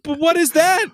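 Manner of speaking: high, loud mock bewilderment; baffled outburst. Dumbfounded customer pressing the store clerk, astonished. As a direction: exclaim it in re-enacted amazement, pitch way up. Retold astonishment at new console.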